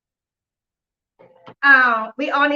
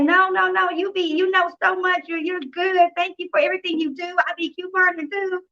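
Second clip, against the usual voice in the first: higher-pitched, gushing mock agent; imitated gushing fan; 6 semitones higher than usual. Agent thanking the caller she follows, imitated high and gushing. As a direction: gush like a fan, voice lifted.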